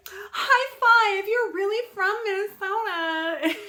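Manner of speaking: high, loud excited imitation of the stylist; mock stylist. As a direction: ham up her gushing cheer. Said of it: "she was like".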